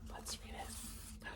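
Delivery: hushed unvoiced whisper. Real, not acted. Hushed aside to child listener.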